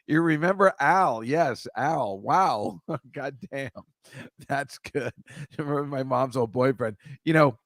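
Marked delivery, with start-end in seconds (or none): none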